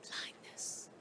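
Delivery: a hushed breathy whisper; whispered title. Real, not acted. Host announces poem title.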